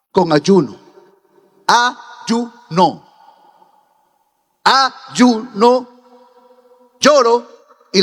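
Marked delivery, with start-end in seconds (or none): 1.7-3.1 "Ayuno" split as A, YU, NO with clear pauses; syllable by syllable. Shown as a demonstration. Preacher breaks word into syllables. 4.6-6.0 "Ayuno" in three separated beats, A, YU, NO, firmly stressed; syllable by syllable. Shown as a demonstration. Preacher repeats word in syllables.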